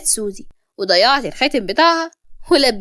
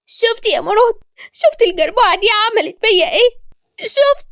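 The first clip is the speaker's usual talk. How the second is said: very high, wailing complaint; hammed-up tearful tattling; 10 semitones higher than usual. A narrator's reading. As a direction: play the weeping victim at a high pitch. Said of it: "وقالت بدموع".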